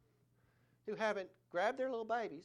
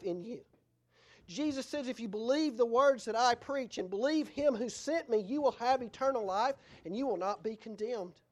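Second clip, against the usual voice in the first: punchy, emphatic sermon voice; forceful preaching; 2 semitones higher, 5 dB louder than usual. Acted. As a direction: drive each promise home. Preaches Jesus' promises forcefully.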